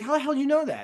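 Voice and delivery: high, incredulous retold question; mock surprise. Man from Leeds spotted by accent, mock surprise.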